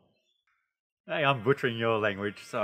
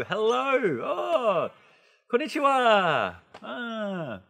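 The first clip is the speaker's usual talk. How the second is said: high, drawn-out delighted hellos; greeting with feeling; 10 semitones higher, 3 dB louder, much slower than usual. Shown as a demonstration. Teacher showing learners how to greet with feeling, gushing and high. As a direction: gush the greeting high and slow, overjoyed.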